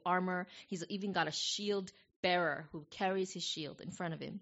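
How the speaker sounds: ordinary delivery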